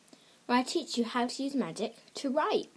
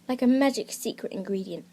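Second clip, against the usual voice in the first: whisper; 2 semitones lower than usual. Pretend. Shares a magic secret.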